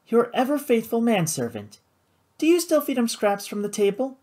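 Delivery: high, mildly mocking feminine voice; scornful mutter. A narrator's reading. Sister mocking her brother's manservant, scornful. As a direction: needle him about his servant, pitched high. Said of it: "she muttered with a scowl".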